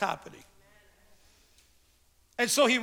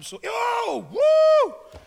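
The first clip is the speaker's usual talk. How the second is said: high, loud joyful whoop, hammed-up; imitating an excited pastor; 12 semitones higher, 6 dB louder, much slower than usual. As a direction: play the pastor cheering way up high with a big whoop. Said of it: "he'll be like".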